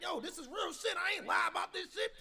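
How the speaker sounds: high, loud heated yell; shouting. Retold or imitated. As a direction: yell it in his face. Yelling at a grown man.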